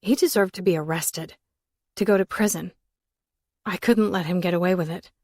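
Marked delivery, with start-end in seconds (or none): none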